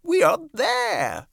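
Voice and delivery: put-on high, slow, bright old grasshopper; cheerful grasshopper. A narrator's reading. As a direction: try to sound cheerful. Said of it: "trying to be cheerful".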